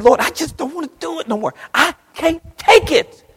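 high, pleading prayer at plain loudness; despairing plea. Retold or imitated. Preacher voicing a sufferer begging the Lord. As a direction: beg the Lord, worn out.

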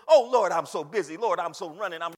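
loud, hurried excuse-making; mock harried believer. Preacher imitating busy believers' excuses, mock harried. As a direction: imitate someone too busy for God.

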